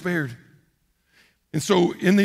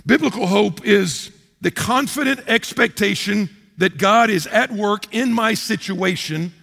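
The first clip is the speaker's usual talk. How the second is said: ordinary talk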